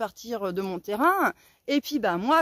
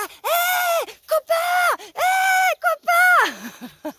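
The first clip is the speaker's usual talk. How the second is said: high, loud yell after the truck; calling out loud; 6 dB louder, much slower than usual. Retold or imitated. Chasing the delivery van, yelling high to the driver. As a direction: shout high across the hamlet to stop him. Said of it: "je hurle dans tout le hameau".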